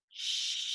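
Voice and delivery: long whispered shh; sh sound. Shown as a demonstration. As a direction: let the breath hiss out as shh. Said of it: "let it out in a sh sound".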